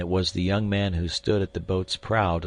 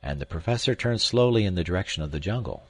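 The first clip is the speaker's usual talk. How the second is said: usual speaking voice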